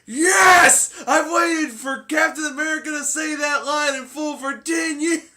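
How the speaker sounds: high, loud, ecstatic movie-theater shout; overjoyed fan's yell. Retold or imitated. Excited fan shouting in the theater at the Avengers line, ecstatic yell. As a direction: yell it out like a fan who waited years. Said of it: "somebody was like".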